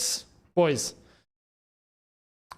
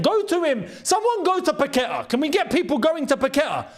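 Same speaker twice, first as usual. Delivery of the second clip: higher, urgent shouts at the screen; frustrated calling out; 6 semitones higher than usual. Real, not acted. Fan urging his defenders at the screen, higher frustrated shouting. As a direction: tell the defenders to close him down.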